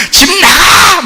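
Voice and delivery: high, put-on parental holler; parent's shout. Parent's yell carrying through thin doors, mock shouting. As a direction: mimic a parent yelling to get out. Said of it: "이런 소리가".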